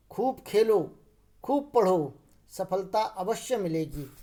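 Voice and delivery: longer stops after "Khelo" and "पढ़ो"; semicolon pauses. Shown as a demonstration. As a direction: pause after "पढ़ो", then slow "सफलता अवश्य मिलेगी". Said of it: "अल्प विराम से थोड़ा सा ज़्यादा रुक रहे हैं".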